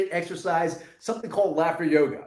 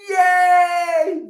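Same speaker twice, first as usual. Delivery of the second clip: high, louder shout of joy; joyful yay shout; 11 semitones higher, 9 dB louder than usual. Shown as a demonstration. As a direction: cheer it out. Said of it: "And say".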